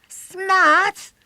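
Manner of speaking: high, loud scoffing exclamation; mock amused cry. A narrator's reading. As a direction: throw it back as if hugely amused. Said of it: "William laughed as though vastly amused".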